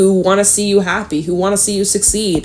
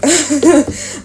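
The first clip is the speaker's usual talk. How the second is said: laughing burst; 8 semitones higher than usual. Real, not acted. Funny mood, laughing.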